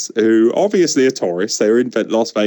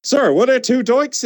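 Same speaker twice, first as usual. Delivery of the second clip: mock interviewer; 10 semitones higher, slower than usual. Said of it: "and he's like".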